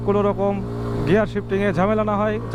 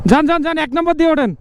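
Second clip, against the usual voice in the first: shouting encouragement; 9 semitones higher, 5 dB louder than usual. Real, not acted. Calling to rider on climb.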